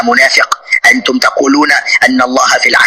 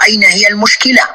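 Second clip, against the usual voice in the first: mocking his words; 7 semitones higher, faster than usual. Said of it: "أنت تقول".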